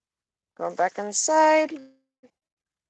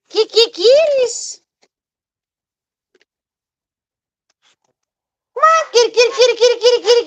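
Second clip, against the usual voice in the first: very high, loud sing-song kitty call; calling kitties; 12 dB louder, faster than usual. Real, not acted. Owner calling her cats back to the coop, high loud sing-song call.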